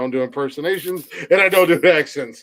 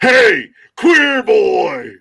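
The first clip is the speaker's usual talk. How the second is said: bully taunt; 7 semitones higher, 6 dB louder, much slower than usual. Retold or imitated.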